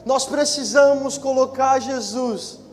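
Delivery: high, loud preaching at full force; slow, emphatic shouted exhortation to the congregation; pregação inflamada. Real, not acted. (pregação fervorosa em voz alta)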